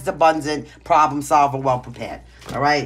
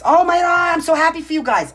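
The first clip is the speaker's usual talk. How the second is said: high, loud burst of joy for the viewers; thrilled gush; 11 semitones higher, 6 dB louder than usual. Real, not acted. Tarot reader overjoyed at a viewer's lucky cards, excited gush. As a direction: squeal it out, genuinely delighted for them. Joy over a good card spread.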